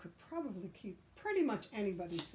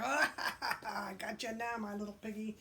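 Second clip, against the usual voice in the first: gloating laugh; 2 semitones higher than usual. Acted. Wolf gloats over ruins.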